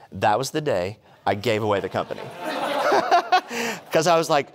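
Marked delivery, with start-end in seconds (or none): 2.3-3.9 high bright laugh at the memory; real laugh. Real, not acted. Laughs at own t-shirt story. 3.9-4.5 laughing. Real, not acted. Laughing while retelling.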